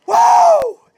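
loud, high, slow preaching shout; hollering. Real, not acted. Preacher hollering at the devil, slow loud shout. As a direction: cry it out to the devil. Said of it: "I'm hollering".

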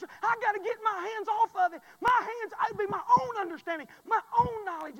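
loud, high, fervent preacher's cry; preaching at full force. Acted. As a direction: preach it hard to the whole church.

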